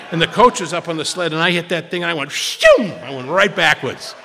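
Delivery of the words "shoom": high, loud whooshing shoom; shoom sound effect. Retold or imitated. Boy hurled back by the sled, loud whooshing shoom. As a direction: fling out a cartoon whoosh.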